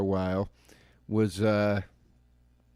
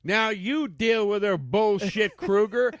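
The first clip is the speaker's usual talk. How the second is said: loud, higher, slowed yell at the ex; hammed-up shout of good riddance; mock yell; 8 semitones higher, 4 dB louder, slower than usual. Husband yelling at Kruger about his ex-wife, mock yell. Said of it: "what Saxon should have yelled there".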